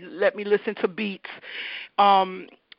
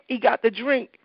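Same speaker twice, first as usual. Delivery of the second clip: ordinary talk, nothing marked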